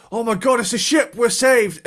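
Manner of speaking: high, loud cries of relief; mock excitement. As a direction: cry out like a rescued castaway, played for laughs. Castaways spotting a ship in retelling.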